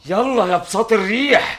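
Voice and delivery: commanding shout. Acted. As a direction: call it out again, rising. Commanding carpet again.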